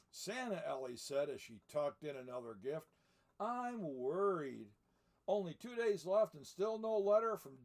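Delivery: lively read-aloud storybook voice; story narration. A narrator's reading. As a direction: read it like a picture book to children. Reads the book aloud.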